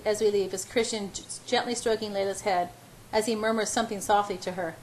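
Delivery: ordinary delivery, nothing marked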